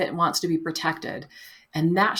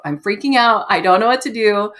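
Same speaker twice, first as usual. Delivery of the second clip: louder, mock freaking out; loud mock panic, higher than her usual voice; 3 semitones higher, 8 dB louder than usual. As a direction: play the panicking inner voice, half in jest. Acting out panicked inner voice.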